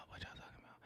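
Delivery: hushed, whispered question. Real, not acted. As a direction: whisper it as if to yourself. Repeats the question under breath.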